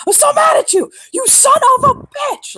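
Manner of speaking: high, loud mock-furious yell; high-pitched raging outburst; mad yelling. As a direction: shriek the insult at full pitch, drawing it out. Acted angry yelling at him.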